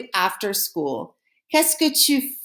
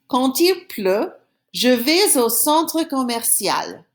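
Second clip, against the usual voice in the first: slow model reading; 2 semitones higher, slower than usual. Shown as a demonstration. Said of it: "Here's an example".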